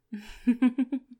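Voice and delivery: loud, high teasing laugh; wicked laugh. Acted. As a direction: laugh with cruel delight. Domme laughing before command.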